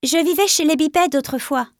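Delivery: bold retort. A narrator's reading. New apprentice answering the veteran about his past, boldly. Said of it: "rétorqua hardiment Nuage de Feu".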